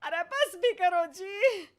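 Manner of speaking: high, loud, slow pleading wail; wife begging her teasing husband to stop; exasperated cry. Acted. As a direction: beg him to stop, drawn out.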